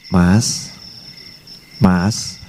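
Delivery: a hint of a sweet young wife, soft and low; lightly voiced new bride. New bride tenderly calling her husband, lightly voiced. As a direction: coo the husband's name gently. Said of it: "manten baru nyebut suaminya".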